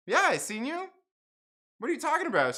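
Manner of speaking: high-pitched, exasperated comeback; incredulous, argumentative storytelling voice; acted indignant retort. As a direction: replay your baffled side of a quarrel. Replays his retort in the quarrel.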